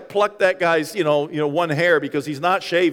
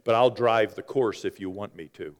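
cocky entitlement; 9 semitones lower, 5 dB quieter, faster than usual. Retold or imitated. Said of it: "you're just like".

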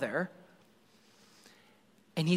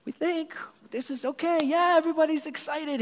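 much higher, airy, oblivious voice; clueless disciples; 11 semitones higher than usual. Retold or imitated. Preacher mocking the disciples who don't get it, high and airy. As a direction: play the disciples as cheerfully missing the point. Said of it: "The disciples are like".

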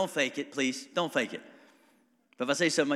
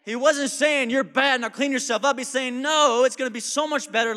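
loud, high preaching build; full-throated pulpit delivery; fiery preaching; 10 semitones higher, 9 dB louder than usual. Acted. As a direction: preach it hard to the room.

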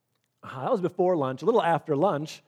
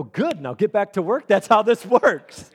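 laughing command; 5 semitones higher, 8 dB louder than usual. Retold or imitated. Laughing boss, retold.

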